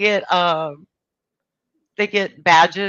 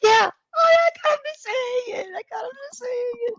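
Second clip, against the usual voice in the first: high triumphant squeal; excited shout; faster than usual. Real, not acted. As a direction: crow with delight, laughing. Triumph after tricking him.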